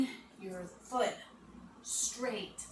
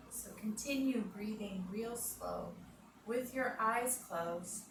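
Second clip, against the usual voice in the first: guided relaxation; 3 dB quieter than usual. Acted. Yoga teacher guiding children, calm and soft. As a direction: lull the kids into calm breathing.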